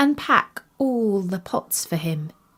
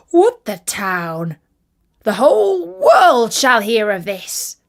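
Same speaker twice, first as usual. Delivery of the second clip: rising to a louder proud exclaim; 5 dB louder than usual. Acted. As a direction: start slow, then burst out with pride. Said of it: "he exclaimed".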